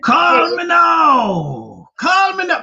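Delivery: high, drawn-out call me now shout; hammed-up TV psychic hotline plea; Miss Cleo impression. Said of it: "that woman, Miss Cleo".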